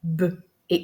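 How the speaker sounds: "B, E" as beu, pause, é; two isolated sounds for "B, E": beu, then é; segmented into phonemes. Shown as a demonstration. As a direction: sound out "B, E" separately. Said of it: "je vais encore entendre".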